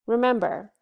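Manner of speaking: normal talking voice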